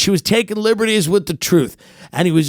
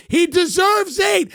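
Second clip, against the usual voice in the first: shouted rant; 11 semitones higher than usual. Acted. Fan insisting his podcaster deserves more houses, shouting. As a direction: hammer it out like a zealot.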